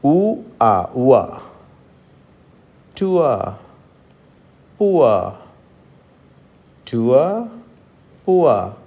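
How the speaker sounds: టువా with ఉ gliding into ఆ; u-a diphthong. Shown as a demonstration. Teacher drills the u-a sound for students. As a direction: glide ఉవా, no r at the end. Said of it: "ఇది పలకడానికి మీకు కాస్త practice అవసరము".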